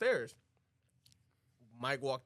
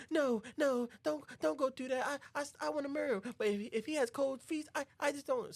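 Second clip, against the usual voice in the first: high-pitched hesitant plea; imitated pleading bride; 13 semitones higher than usual. Bride begs Mike not to tell Rose, high plea. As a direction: plead as the bride, stumbling on words.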